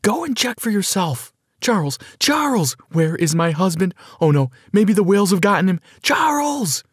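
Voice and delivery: high, frantic woman calling her husband; screaming. A narrator's reading. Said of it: "she continued screaming".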